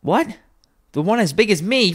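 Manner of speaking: put-on high, incredulous child's voice; reply as an amazed little lad; high-pitched boy in surprise. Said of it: "returned the boy".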